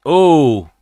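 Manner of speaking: a sudden high, loud ooh at the punch; excited ooh. Real, not acted. Viewer watching a fight reacts to a punch with an excited ooh. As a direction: react like the blow just landed.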